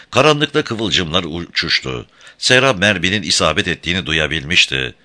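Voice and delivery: ordinary delivery, nothing marked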